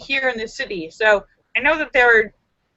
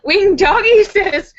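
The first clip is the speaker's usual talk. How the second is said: ordinary delivery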